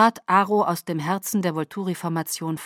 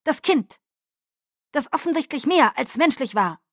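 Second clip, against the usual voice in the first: 1 pressing; 7 semitones higher, 3 dB louder than usual. A narrator's reading. Caius pressing Irina angrily.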